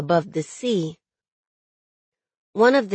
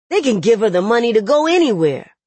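fast, loud agitated retort; heated, hurried insistence; upset insistence; 4 semitones higher, 6 dB louder, faster than usual. A narrator's reading. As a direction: rush it out with voice raised. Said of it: "never seen him so upset before".

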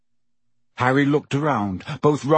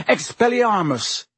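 high, sharp yelled spell; Harry yells the spell at Snape; 8 semitones higher than usual. A narrator's reading. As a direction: fire the spell with a shout. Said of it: "he yelled".

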